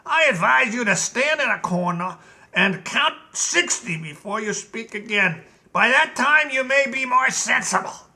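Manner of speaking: a hint of a smug general; lightly voiced general. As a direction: talk down to the king. General lectures the king.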